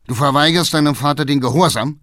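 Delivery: fast, loud, high question; outraged challenge. A narrator's reading. Father facing his son's disobedience, outraged. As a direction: demand it in disbelief and anger.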